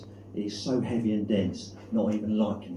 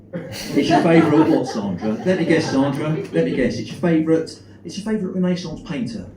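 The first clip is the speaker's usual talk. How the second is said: mock exasperation; 9 semitones higher, 6 dB louder than usual. Exasperated guessing game with call-centre Sandra.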